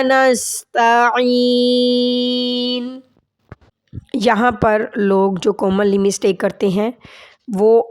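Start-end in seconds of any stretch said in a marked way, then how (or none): 0.0-3.0 slow drawn-out tajweed recitation; Quranic recitation. Shown as a demonstration. Teacher reciting slowly for students.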